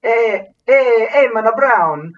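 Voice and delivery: loud, high mock-Italian voice; Italian accent. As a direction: put on a hammed-up Italian accent. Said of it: "someone with an Italian accent says".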